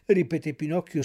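ordinary delivery, nothing marked

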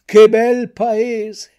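loud, slow wonder; mouth-watering delight. A narrator's reading. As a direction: relish every word, big and slow. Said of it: "sentendo venirsi l'acquolina in bocca".

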